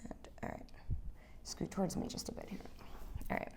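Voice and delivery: soft whisper while working. Real, not acted. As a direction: whisper the instructions gently. Adjuster muttering while adjusting.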